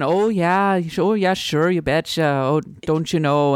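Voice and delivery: light mock Minnesota accent with long O's; a hint of Fargo-style Minnesota accent. Shown as a demonstration. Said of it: "don't go full on".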